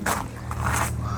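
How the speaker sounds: breathy, hushed wow of awe; whispered wow. Real, not acted. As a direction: let it slip out under your breath. Stumbling on a ruin.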